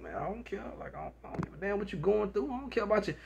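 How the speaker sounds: soft, careless mock shrug; callous indifference. Careless man telling his partner he doesn't care, softly.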